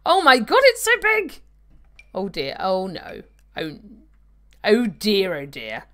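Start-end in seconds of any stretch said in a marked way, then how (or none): none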